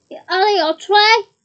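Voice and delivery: loud startled yelp. A narrator's reading. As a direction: yelp it out in alarm. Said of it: "yelped Sam".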